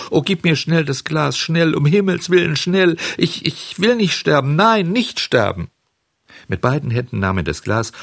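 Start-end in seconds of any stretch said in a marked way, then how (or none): 0.1-5.7 high, loud, panicked begging; high-pitched child in terror of dying; frantic pleading. A narrator's reading. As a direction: beg desperately for your life. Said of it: "flehte Bengele".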